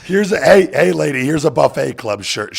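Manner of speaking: loud, high call to a complainer; hollered, dismissive mock offer; mock brush-off yell. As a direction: shout her down with a freebie.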